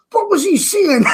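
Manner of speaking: high, loud mock bafflement; baffled exclamation. Man retelling his reaction to strong accents, mock baffled. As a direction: blurt it out high-pitched, playing baffled.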